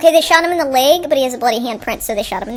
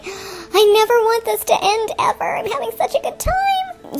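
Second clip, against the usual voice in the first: much higher, slow, gushing; thrilled moviegoer; 8 semitones higher, slower than usual. Retold or imitated. A moviegoer in the theater who never wants the film to end, much higher and slow. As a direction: drawl out pure delight at the movie. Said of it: "you'd be like".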